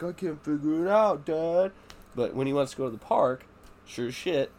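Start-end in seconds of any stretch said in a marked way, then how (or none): 0.0-1.7 put-on high, whining child; whiny helpless kid. Son pretending he cannot dress himself, whining. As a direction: play the helpless little boy. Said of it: "He's like".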